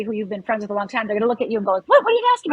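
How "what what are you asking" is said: high, loud, taken-aback friend; stunned what are you asking; surprised friend. Retold or imitated. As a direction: play the startled friend, pitched way up. Friend reacts to the request.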